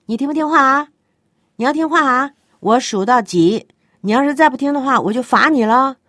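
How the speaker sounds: put-on loud, high warning to a disobedient child; hammed-up angry parent counting down; nagging parent. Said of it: "有的做父母的呢，很有意思".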